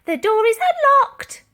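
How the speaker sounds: very high, loud sing-song call; old lady stalling the police at the door. A narrator's reading. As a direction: call sweetly to stall them. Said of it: "Dotty called out".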